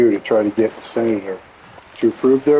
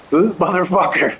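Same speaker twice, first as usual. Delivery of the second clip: laughing while telling; 6 semitones higher than usual. Real, not acted. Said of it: "I couldn't stop laughing".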